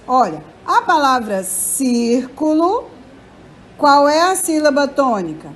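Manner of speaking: ordinary talk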